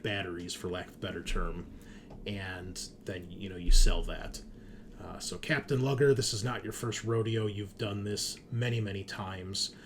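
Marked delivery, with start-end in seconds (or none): none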